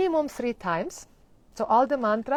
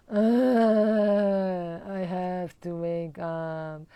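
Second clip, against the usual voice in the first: slow, whiny groggy complaint; mock sleepy grumble; groggy reluctance; 3 dB louder, much slower than usual. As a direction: drag it out like someone who won't get up. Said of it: "the pattern of snoozing".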